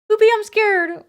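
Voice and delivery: high, loud frightened whine; high-pitched scared wail; scared squeal. Real, not acted.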